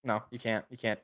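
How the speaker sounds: usual speaking voice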